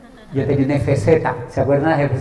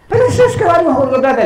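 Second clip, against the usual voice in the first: whiny protest; 13 semitones higher, 7 dB louder, faster than usual. Retold or imitated. Employee protests in high voice.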